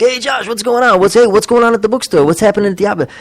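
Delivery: high-pitched, gushing mock greetings; eager greeters. Townspeople stopping Josh on the square, eagerly greeting. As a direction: imitate chatty townsfolk all hailing him.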